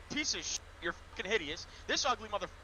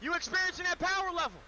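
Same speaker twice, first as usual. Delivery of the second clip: hyped shout; 7 semitones higher, 8 dB louder than usual. Acted. Acting out magic power.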